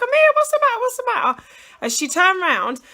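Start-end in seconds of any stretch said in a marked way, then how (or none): 0.0-1.4 high, loud alarmed calling out; retold panicked call. Mum hearing daughter scream, panicked high call. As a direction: replay the panic of finding her hurt. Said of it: "and I'm like".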